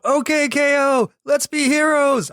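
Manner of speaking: high fake yell without the volume. Shown as a demonstration. Announcing the show's title to listeners, fake yell. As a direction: yell it without really yelling. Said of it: "I'm gonna yell it without yelling it".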